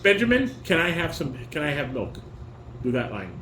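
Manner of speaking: normal talking voice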